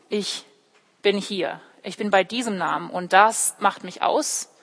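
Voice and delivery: ordinary talk, nothing marked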